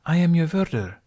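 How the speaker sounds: a hint of a solemn guardian spirit; lightly voiced woman. A narrator's reading. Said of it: "she said gently".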